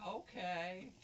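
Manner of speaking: high, loud, rising mock eagerness; imitated eager ladies. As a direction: chirp it like a flattered fan. Ladies accept the invitation, retold.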